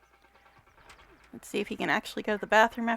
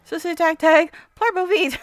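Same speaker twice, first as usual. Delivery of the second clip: high, loud Simlish babble; playful Sim voice, pitched up; 7 semitones higher, 5 dB louder than usual. Pretend. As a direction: rattle off game gibberish in a high voice. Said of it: "you really do know the Simlish".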